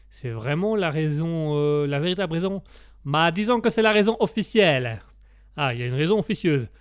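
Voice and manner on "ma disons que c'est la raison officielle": evasive mock Italian accent. Italian guest gives the host the official reason, coy Italian accent. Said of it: "comme on l'entend à mon accent".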